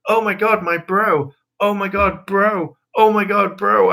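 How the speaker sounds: hammed-up parody of Nate's bro talk; mocking Nate. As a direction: squeal it like an overexcited fan.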